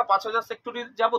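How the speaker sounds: ordinary talk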